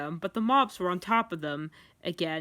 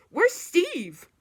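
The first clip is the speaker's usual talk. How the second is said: high, louder cry of sudden worry; alarmed; 10 semitones higher, 6 dB louder than usual. A narrator's reading. Sonic notices Steve is missing, alarmed. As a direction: call out in alarm.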